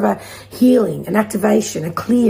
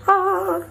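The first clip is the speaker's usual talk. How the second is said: happy cry; 11 semitones higher than usual. Acted. Joyful exclamation after sing.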